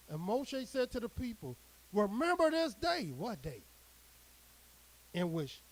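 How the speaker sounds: measured, declaiming scripture read; solemn preacher reading the Word. Acted. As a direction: proclaim the verse to the flock. Reads scripture aloud to congregation.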